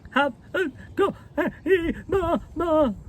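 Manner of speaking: put-on very high, crying child's voice; howling little girl. A narrator's reading. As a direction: sob it out like a tantrum. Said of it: "Lottie howled tempestuously".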